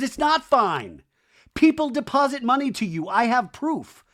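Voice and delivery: high, loud accusing retort; heated confrontation. Retold or imitated. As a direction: throw the accusation out high and forceful. Recapper acts out Michelle's angry confrontation.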